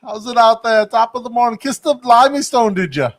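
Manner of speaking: high-pitched, badly faked European accent; mock Irish-British lilt, pitched well up; horrible European accent. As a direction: put on a hammy, not-quite-Irish accent. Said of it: "it's some horrible European accent".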